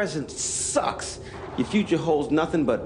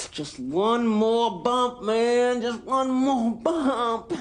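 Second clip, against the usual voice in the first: high, loud, drawn-out pleading; begging addict; 8 semitones higher, 4 dB louder, much slower than usual. Acted. Fiend on the street begs for another bump, high drawn-out plea. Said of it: "like any fiend on the street".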